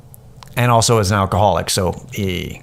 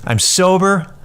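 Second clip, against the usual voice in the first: frustrated plea; 6 semitones higher than usual. Retold or imitated. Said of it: "And I said".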